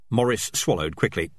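ordinary delivery, nothing marked

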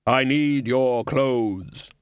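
very slow, drawn-out Death voice. A narrator's reading. Death demanding the Dean's clothes, in a very slow, commanding voice. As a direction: stretch each word out with solemn command.

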